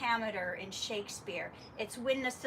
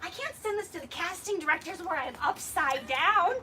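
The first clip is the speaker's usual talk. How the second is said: higher, louder whining protest; mock embarrassed protest; 7 semitones higher, 7 dB louder than usual. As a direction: protest like a mortified actor. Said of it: "I would be like".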